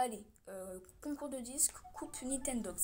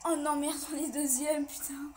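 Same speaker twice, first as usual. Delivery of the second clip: dismayed outburst; 4 semitones higher, 6 dB louder, slower than usual. Real, not acted. Losing first place.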